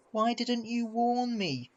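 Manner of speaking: high, slow, aggrieved question; reproachful. A narrator's reading. Battered engine blaming his friend for the accident, reproachful. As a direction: ask it as a wounded complaint.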